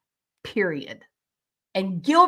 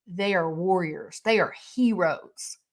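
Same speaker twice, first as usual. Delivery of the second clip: usual speaking voice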